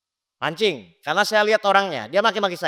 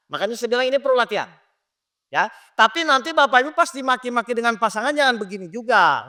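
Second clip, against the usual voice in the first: ordinary talk, nothing marked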